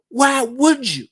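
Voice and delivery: gasp, then high and slow in mock horror; gasping shock. Mock-horrified gasp at a friend's betrayal, high and slow. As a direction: clutch your pearls, drawn-out.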